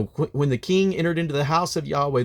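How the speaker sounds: ordinary delivery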